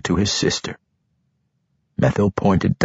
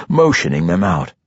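ordinary delivery, nothing marked

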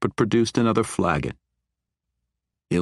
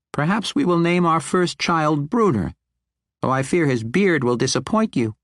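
teasing halfling; 10 semitones higher than usual. Acted. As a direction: tease the dwarf with a grin. Regis teases Bruenor.

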